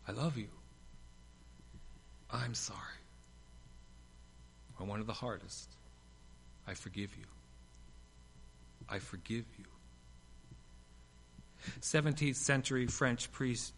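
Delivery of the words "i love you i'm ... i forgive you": soft, tender confessions. Acted. Preacher voicing words long needed, soft and tender. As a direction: offer each softly, like a long-held gift.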